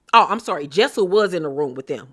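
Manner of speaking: ordinary talk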